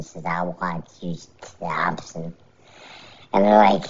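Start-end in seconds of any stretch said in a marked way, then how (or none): 0.3-2.3 low, soft acted challenge; fighter's call-out. Fighter calls out Wonderboy Thompson, low understated challenge. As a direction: lightly voice a fighter picking his opponent, low and quiet. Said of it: "he calls out Stephen Wonderboy Thompson".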